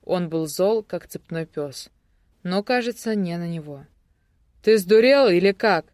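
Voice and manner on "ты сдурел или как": loud, outraged demand; angry yelling. A narrator's reading. Newcomer scolds the captor, yelling. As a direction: raise the voice in a scolding shout. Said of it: "продолжал орать вновь прибывший".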